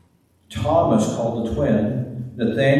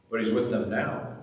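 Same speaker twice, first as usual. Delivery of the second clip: normal talking voice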